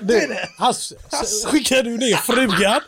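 hammed-up Gothenburg dialect; göteborgska. Gothenburger propositioning Hasse, in mock göteborgska. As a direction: do a cheeky comic Gothenburg accent. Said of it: "Men, och göteborgska?".

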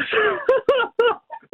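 squealing laugh of shock; high-pitched stunned laugh; high disbelieving laugh. Real, not acted. Winner hears of fifty grand.

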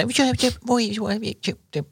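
high-pitched gooey cooing; cutesy baby talk. Shown as a demonstration. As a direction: coo as if fussing over a baby. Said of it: "Sössöttää? Siis onko semmoista".

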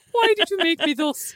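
high, theatrical mock lament; mock-dramatic plea. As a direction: ham it up as a lament to Da. Hammed-up line to "Da.

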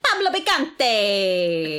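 put-on slow, drawn-out Italian accent. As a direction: roll it out in a hammy Italian accent. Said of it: "That's what the Italian accent you just did".

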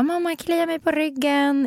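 put-on high, drawn-out little-kid voice; imitated child pleading. Mother imitating her wakeful child at night, pleading. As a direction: stretch it out like a child nagging mum.

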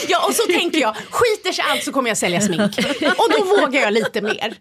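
talking through real laughter; laughing. Real, not acted. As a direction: laugh while you joke about a backup. Laughs at the sales joke. (skrattar medan hon pratar)

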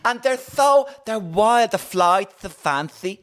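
high-pitched, drawn-out character impression; put-on character voice. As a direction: act it out high, stretching the key words.

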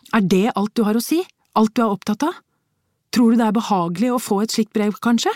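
high, loud, fast bitter reproach; wife rebuking husband over a threatening letter, bitter; bitter rebuke. A narrator's reading.

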